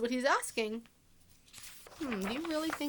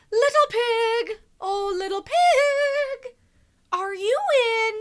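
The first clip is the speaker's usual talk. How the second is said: higher, louder sing-song call, slow; 13 semitones higher, 11 dB louder, slower than usual. Acted. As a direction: croon it sweetly to the pig's door. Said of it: "so I called".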